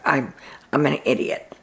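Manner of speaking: hushed, self-reproaching whisper; regretful whisper. Retold or imitated. Houseguest whispering regret over his blunder to the others, hushed. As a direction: breathe it out like a quiet confession.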